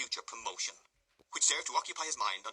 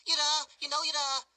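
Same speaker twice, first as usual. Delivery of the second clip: much higher, louder whining blubber; 8 dB louder than usual. A narrator's reading. Noah blaming Charlotte to the beadle, blubbering. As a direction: whine and snivel the blame onto her. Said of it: "retorted Noah".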